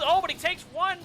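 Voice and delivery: sudden high, loud burst of excitement; a shout of live hype; excited caster outburst. Real, not acted. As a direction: jump up as the play turns. Caster reacts to a big play.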